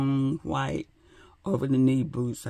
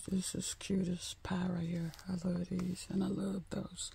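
hushed and much softer, admiring; soft whisper; 3 semitones higher, 9 dB quieter than usual. Real, not acted. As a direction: breathe it like a secret about cute shoes. Admiring shoes softly.

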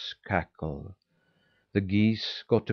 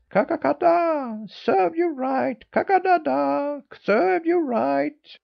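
high, loud cackle with drawn-out words; crying hens; 5 dB louder than usual. A narrator's reading. Hens taunting the boy all at once, loud high cackling. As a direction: taunt like a cackling hen on one high note. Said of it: "they all cried at once".

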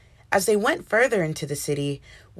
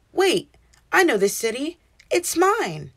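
surprised; 8 semitones higher, 4 dB louder than usual. A narrator's reading. George recognizes his own city.

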